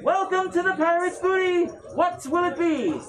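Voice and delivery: put-on old merchant welcoming a customer, sing-song; cheery old merchant. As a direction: greet customers like a beaming old shopwoman. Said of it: "She says".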